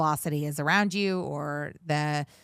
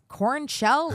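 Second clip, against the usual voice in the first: much higher, puzzled rising "Corn shell?"; confused question; 10 semitones higher, 4 dB louder than usual. Retold or imitated. Coworker baffled by the jargon, higher mock confused question. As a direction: echo the jargon back, baffled and a little louder. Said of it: "somebody else in the room goes".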